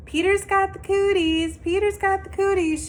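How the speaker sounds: high, loud schoolyard taunt; yelling. Acted. Girl chasing a boy in the playground, yelling a high loud taunt. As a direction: chant it to tease him. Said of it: "she yelled".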